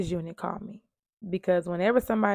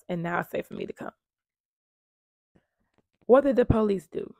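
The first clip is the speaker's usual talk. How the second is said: normal talking voice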